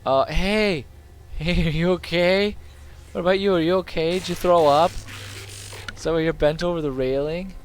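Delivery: high-pitched checking-in chatter; playfully concerned high voice; calling out. Pretend. Calling to game character.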